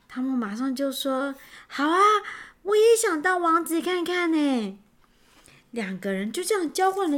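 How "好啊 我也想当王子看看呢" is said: higher, keen yes from Tom; eager agreement. A narrator's reading. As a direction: jump at the offer with a lifted voice. Tom jumps at the prince's offer. (兴奋地一口答应)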